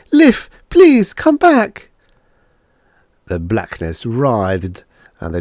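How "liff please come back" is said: high-pitched, loud desperate begging; screamed plea. A narrator's reading. Woman begs Liff to return, screaming. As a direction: beg at the top of your voice. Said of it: "she screamed".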